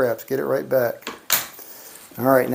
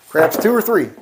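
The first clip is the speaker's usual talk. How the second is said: louder and higher plea for craps; 5 semitones higher, 5 dB louder than usual. Real, not acted. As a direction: call it out to the dice, higher and louder. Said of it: "Just give me a craps two or three".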